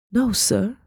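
small, high voice; timid reply. A narrator's reading. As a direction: answer the master meekly. Girl answers her master timidly.

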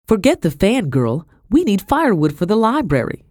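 high, stern order. A narrator's reading. Mistress sending the servant girl for firewood, stern. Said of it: "she said sternly".

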